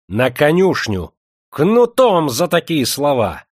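high, loud, drawn-out furious order; losing his temper. A narrator's reading. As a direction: rage out the punishment. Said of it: "вышел из себя дворянчик". (яростный приказ, вне себя)

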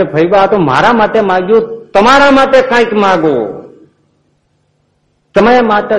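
Maharaj urging. Acted. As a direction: press her warmly to ask for herself. Maharaj insists again.

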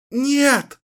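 high, loud, desperate scream of no; desperate cry. Acted. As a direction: cry out in despair to stop it. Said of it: "отчаянно закричала Кэлен". (отчаянный крик)

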